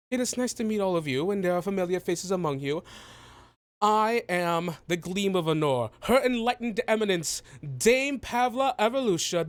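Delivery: high, pompous self-introduction; lofty, theatrical proclamation; grandiose halfling dignitary. Acted. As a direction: roll out titles with regal pride. Halfling announces her many titles.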